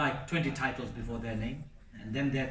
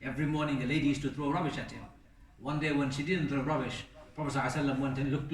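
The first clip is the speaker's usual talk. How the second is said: ordinary talk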